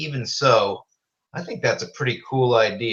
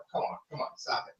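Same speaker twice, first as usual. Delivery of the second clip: hushed scolding; 13 dB quieter, faster than usual. Real, not acted. Hushing cats off-mic.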